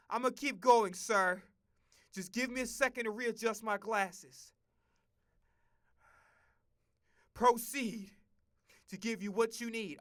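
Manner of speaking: loud, stiff yes-sir reply; mock soldier reply. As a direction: bark it back like a recruit. Mock obedient recruit reply.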